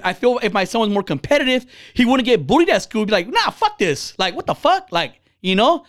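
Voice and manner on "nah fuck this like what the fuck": higher-pitched, indignant brush-off; defiant refusal. Retold or imitated. Imagined competitive son to school bullies, defiant. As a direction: imitate a kid refusing to be pushed around.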